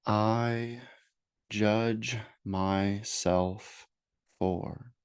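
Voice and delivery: slow meditation voice with long pauses; guided meditation. Acted. Therapist leading listeners to name self-judgments, slow guided meditation. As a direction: guide them unhurriedly, leaving space after each phrase.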